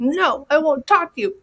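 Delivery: a lightly voiced child turning her back; sulky little girl. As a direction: sulk like a hurt little girl. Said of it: "I talk to my little girl".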